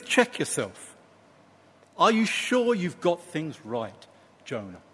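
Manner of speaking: quiet, kindly correction; very gentle. Acted. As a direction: gently invite him to reconsider. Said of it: "he says it very gently".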